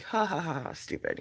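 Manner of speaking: soft, dismissive fake laugh; half-hearted mock chuckle. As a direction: pretend to laugh off something silly. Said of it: "we can be like".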